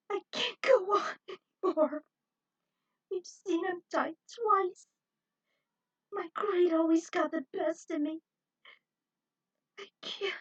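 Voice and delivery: falling apart, nearly in tears; despairing. Acted. Jenny breaking down in despair.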